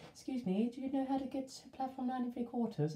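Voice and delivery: put-on high, sincere posh Chelsea accent; polite posh young Chelsea boy. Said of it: "very Chelsea accent you could come across".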